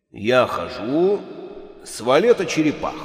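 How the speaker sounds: медленно, низко, весомо объявляет ход; гулкий голос из-за двери; медленный низкий голос. Acted. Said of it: "объявил голос за дверью". (медленный гулкий голос из-за двери)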